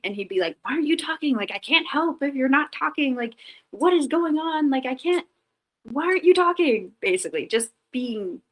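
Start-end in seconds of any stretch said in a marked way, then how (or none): 0.6-6.9 higher, exasperated mock complaint; imitating upset husband. Husband frustrated by his silent wife in the car, higher mock exasperation. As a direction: play the frustrated partner pleading, pitched up. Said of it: "he would get upset with me".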